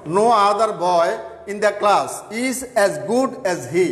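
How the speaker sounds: very slow, word by word; slow, deliberate example sentence; slow dictation. Shown as a demonstration. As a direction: read it out slowly for the class to copy. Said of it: "যেমন এখানের মধ্যে দেখো".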